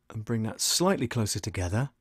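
ordinary talk, nothing marked

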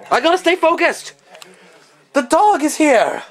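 high-pitched panicked cry; mock panic. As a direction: squeal it in theatrical alarm. Mock panic about the dog.

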